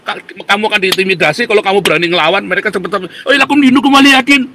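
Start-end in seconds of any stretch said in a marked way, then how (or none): 3.2-4.5 high, loud sneering singsong of the phrase; mocking quote. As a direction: parody their pious line, higher and louder.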